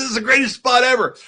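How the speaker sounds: hyped-up praise. Retold or imitated. Still hyping Vader.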